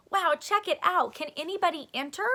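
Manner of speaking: high, loud, quick eager question; excited wow. Acted. Kid thrilled by the contest, eager and fast. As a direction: burst out with excitement.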